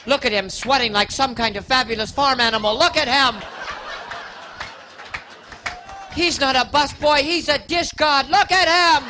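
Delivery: a woman drooling over the busboy to her friend, building to a swoon; woman gushing. Retold or imitated. Woman ogling busboy at Denny's.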